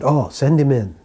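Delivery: retold bright-eyed "bring him" call; eager welcome. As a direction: imitate someone eagerly welcoming a guest. Retold guru welcomes visitor eagerly.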